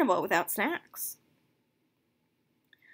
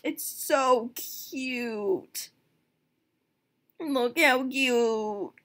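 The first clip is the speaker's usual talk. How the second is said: drawn-out gush; 3 semitones higher, much slower than usual. Real, not acted. Drawn-out gushing.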